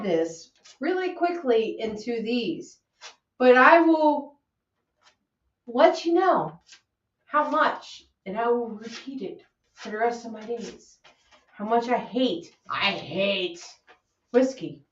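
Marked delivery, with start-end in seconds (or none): 3.4-14.8 hamming up a sworn oath against whiskey; mock solemn vow. Friend declares to Chad how much she hates whiskey, mock solemn.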